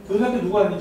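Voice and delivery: ordinary talk, nothing marked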